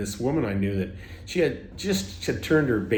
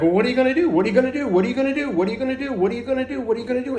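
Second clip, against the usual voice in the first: much higher, insistent, looping the question; nagging ego voice; 12 semitones higher, much faster than usual. Retold or imitated. His ego badgering him about leaving Canada, high insistent nagging. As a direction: needle him over and over. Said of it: "the ego was going like".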